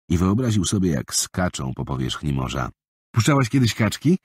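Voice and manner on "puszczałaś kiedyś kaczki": put-on higher boyish question; a small boy eager to show a trick; young boy's voice. Boy asks girl. (głos małego chłopca)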